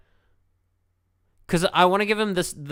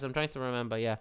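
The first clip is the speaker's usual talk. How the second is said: ordinary talk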